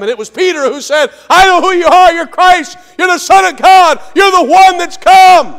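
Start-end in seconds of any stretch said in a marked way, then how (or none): 1.3-5.5 shouted, high, loud confession; Peter's excited confession. Acted. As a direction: cry it out like a man who finally sees.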